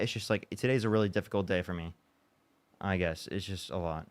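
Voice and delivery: letting the hurt show, trailing away quietly; emotional and worn down. Real, not acted. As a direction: voice sinking low and soft, drained. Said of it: "to be so emotional right now".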